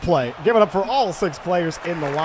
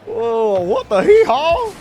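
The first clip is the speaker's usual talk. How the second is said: high, drawn-out disbelief; slow, squealing double take; astonished exclamation; 12 semitones higher, 5 dB louder, much slower than usual. Real, not acted. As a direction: stretch it out way up high. Astonished at the play.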